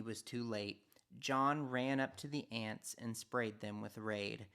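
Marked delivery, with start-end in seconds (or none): none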